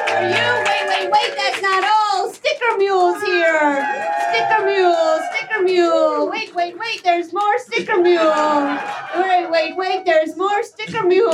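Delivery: high, shouted game-show hype for the prize; excited game-show announcer reveal; hyped prize announcement. Acted. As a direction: sell the giveaway like a TV host, wait wait wait. Host hypes prize giveaway.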